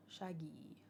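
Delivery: a breathy whispered murmur. Real, not acted. Whispering the name while thinking it over. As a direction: whisper the word to yourself.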